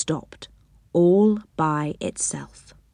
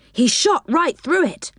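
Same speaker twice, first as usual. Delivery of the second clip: usual speaking voice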